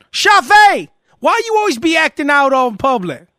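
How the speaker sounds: high, loud put-on mama yell; imitated mother shouting in public; mock scolding mama. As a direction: holler at the kid like a fed-up mother.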